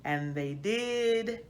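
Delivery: put-on lively storybook narration for children; story reading. A narrator's reading. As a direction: read it like a bedtime picture book to kids.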